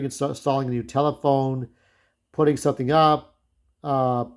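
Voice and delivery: usual speaking voice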